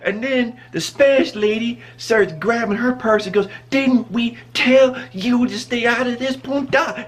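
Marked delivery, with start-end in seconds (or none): 3.7-7.1 slow, high, word-by-word imitated telling-off; mock furious scolding. Spanish lady hitting the bystander, slow high mock scolding. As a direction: punch out each word as she swings the purse.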